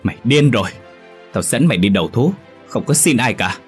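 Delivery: father refusing his son's plea, angry bark; barking at him. Acted. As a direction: snap at him, no begging. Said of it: "Ông Tị quát".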